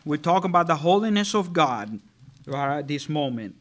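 ordinary delivery

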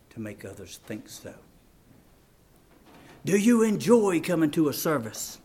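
grave, quiet appeal with long pauses; hushed preaching. Real, not acted. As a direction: ask it softly and let it sink in. Searching questions to congregation.

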